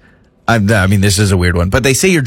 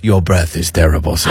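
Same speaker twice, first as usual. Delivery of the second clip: haughty French waiter; 8 semitones lower than usual. Retold or imitated. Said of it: "And he goes".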